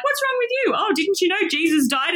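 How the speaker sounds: high-pitched, playfully incredulous question; mock incredulity. Disciple on the Emmaus road chides an unrecognised Jesus, mock incredulous.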